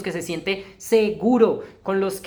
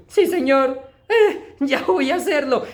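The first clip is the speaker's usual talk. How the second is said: higher, louder, laughing answer; laughing yes; 7 semitones higher, 4 dB louder, slower than usual. Retold or imitated. Preacher retelling his answer to God, with a laugh.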